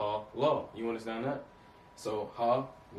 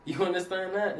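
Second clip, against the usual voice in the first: laughing; 8 semitones higher, 4 dB louder than usual. Real, not acted. Laughing at his own joke.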